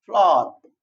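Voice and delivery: फ्लावर spoken clearly as a falling single word; model pronunciation. Shown as a demonstration. Teacher answers own question for students, modelling the word. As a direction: give फ्लावर as the answer, cleanly. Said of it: "क्या पढ़ा जाता है?".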